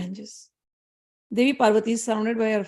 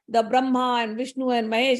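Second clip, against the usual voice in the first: plain reading voice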